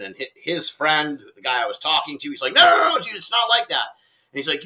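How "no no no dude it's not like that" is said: high, loud no no no to calm things; urgent shout. Retold or imitated. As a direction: rush in to stop the fight. Friend defusing the confrontation.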